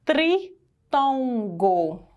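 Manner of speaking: tri-ton-go, each syllable drawn long; slow, drawn out. Shown as a demonstration. Teacher spelling out a new term slowly. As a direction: stretch out tritongo syllable by syllable. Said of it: "A gente chama de".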